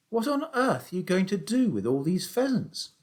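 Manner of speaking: a hint of a curious boy; young boy Danny. A narrator's reading. Danny asking his dad about the pheasants, lightly boyish.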